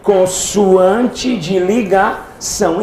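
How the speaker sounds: very slow, every syllable spelled out; slow classroom dictation; slow teaching answer. Shown as a demonstration. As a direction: drill the term slowly into the class. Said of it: "Como é que é o nome desse Z?".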